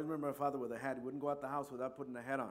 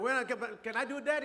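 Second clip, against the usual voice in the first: put-on higher, eager little kid asking; child's voice; 8 semitones higher, 6 dB louder, faster than usual. The boy asking his daddy for a turn, higher and eager.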